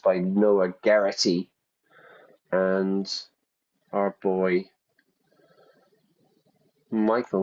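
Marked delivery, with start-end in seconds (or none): none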